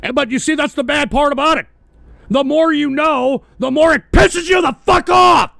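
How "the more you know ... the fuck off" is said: loud, high shout of frustration; angry outburst. Real, not acted. Host venting to listeners about knowing too much, angry shout. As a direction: build to a furious shout on the last words.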